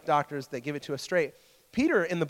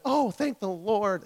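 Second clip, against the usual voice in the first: higher, slow, drawn-out sigh of thanks; acted relief; 6 semitones higher, 3 dB louder, slower than usual. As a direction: play a family's big relief, stretching the words. Family relieved at good news.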